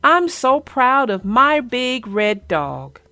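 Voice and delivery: ordinary delivery, nothing marked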